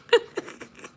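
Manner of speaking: breathy, voiceless laughter; amused laugh. Real, not acted. Host tells viewers he's snoring, voiceless laugh. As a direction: laugh helplessly without voice.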